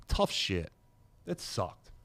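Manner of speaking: normal talking voice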